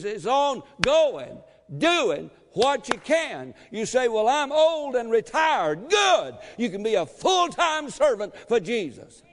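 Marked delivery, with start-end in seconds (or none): 4.1-5.9 mock retiree excuse. Voicing a retiree's excuse, shouted. 5.9-9.2 preaching shout. Real, not acted.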